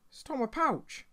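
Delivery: high indignant cry of disbelief; outraged squeak. Real, not acted. Gamer finds bandits took his pouch, high indignant yelp. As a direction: yelp it as the theft sinks in.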